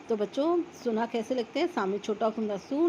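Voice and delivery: ordinary delivery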